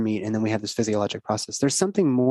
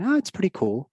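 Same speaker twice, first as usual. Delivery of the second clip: put-on higher little girl voice; a light child voice; daughter's voice; 9 semitones higher than usual. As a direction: voice the child casually. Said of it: "And she said".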